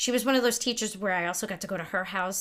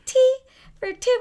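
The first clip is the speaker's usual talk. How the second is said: much higher and louder mock singing; imitating Stacey; 11 dB louder than usual. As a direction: squeal the line like Stacey does. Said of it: "My friend Stacey talks like this".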